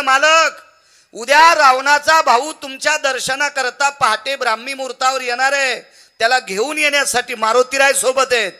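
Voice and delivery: high, loud storytelling declamation; preaching at full force. Acted. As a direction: proclaim the news to the whole crowd.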